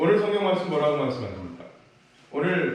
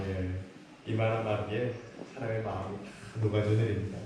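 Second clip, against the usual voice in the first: ordinary talk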